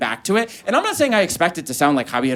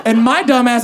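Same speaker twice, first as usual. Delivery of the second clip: ordinary talk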